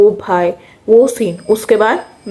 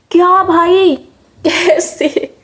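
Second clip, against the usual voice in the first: exasperated disbelief; 7 semitones higher, much slower than usual. Real, not acted. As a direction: throw up your hands at yourself. Disbelief at own blunder.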